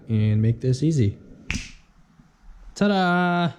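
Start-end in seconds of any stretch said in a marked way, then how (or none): 2.8-3.5 high, sing-song reveal; playful ta-da. Pretend. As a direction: present it like a magic trick. Showing the engine already pulled.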